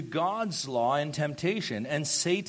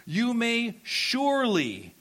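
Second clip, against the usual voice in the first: surely stressed high and slow; emphasis on surely; 8 semitones higher, slower than usual. Shown as a demonstration. Preacher stresses God's actual words, "surely" emphasized. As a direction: lean hard on "surely" sung out high.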